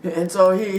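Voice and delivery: ordinary delivery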